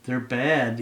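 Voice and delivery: ordinary delivery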